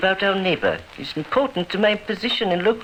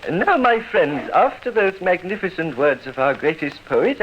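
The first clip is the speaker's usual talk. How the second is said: ordinary delivery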